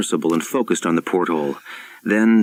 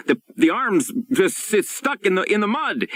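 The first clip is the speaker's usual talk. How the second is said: panicked yell; 13 semitones higher than usual. A narrator's reading. Saint John yelling up from the trapped sub, panicked. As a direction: blurt it out in panic, tripping over words. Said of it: "he yelled".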